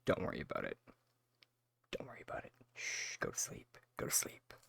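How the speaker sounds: whispered lullaby soothing; whispering over a crib. Retold or imitated. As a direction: whisper it softly to sleep. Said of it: "put the system in a headlock and it's just like".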